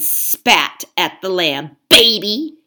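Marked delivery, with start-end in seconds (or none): 1.9-2.6 1 hissed. Acted. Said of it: "he hissed".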